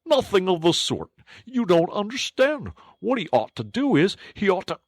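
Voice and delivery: a squeaky know-all interrupting; Mole arguing. Acted.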